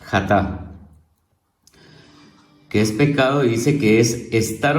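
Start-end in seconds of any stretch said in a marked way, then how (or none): 0.0-0.6 jatá with the final A stressed, xa-TA; jatá, accent on the final syllable; stress on last syllable. Shown as a demonstration. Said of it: "en la última sílaba".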